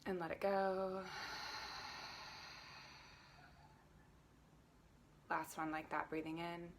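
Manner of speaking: soft, unhurried breath cues; gentle meditation teacher voice; guided breathing. Acted. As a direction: guide the group's breath calmly. Leads breathing at class start.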